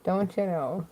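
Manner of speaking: softly said Canadian "Don't you know"; Canadian accent. Real, not acted. [name] obeys a request to say it, Canadian accent.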